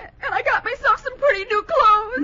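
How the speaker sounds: high-pitched tearful blubbering; crying. Acted. Bessie tells Gildersleeve of her new clothes, crying. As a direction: sob through each phrase. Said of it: "Why are you crying?".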